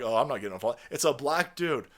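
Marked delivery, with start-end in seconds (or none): 0.0-0.8 lightly voiced timid bystander, lower; mock bystander. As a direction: shrug it off like a meek onlooker.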